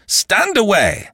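high, drawn-out shout; shouted command. A narrator's reading. André Louis about to fire at his enemy, shouting. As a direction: raise the pitch sharply and order her off. Final order before he fires.

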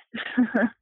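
short nervous giggle; little uneasy laugh. Real, not acted. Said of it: "are you aware of how much you laugh".

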